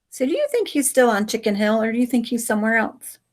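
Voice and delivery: ordinary delivery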